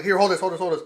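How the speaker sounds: loud, high, hurried repetition; frantic re-enacted plea; retold urgent handoff. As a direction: blurt it urgently while jumping into action. Acting out urgently handing off joints.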